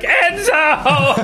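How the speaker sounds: high-pitched drawn-out mock yell; dad's scolding call. Dad blaming the dog at a family gathering, high mock yell.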